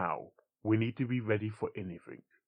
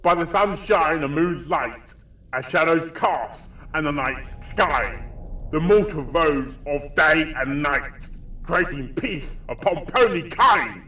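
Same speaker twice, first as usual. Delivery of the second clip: loud, slow, higher incantation; recited prayer; 7 semitones higher, 12 dB louder, slower than usual. Acted. Coltstein reciting the prayer at the demon, loud slow chant. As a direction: cry the prayer out over the shrieking. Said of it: "to recite the prayer".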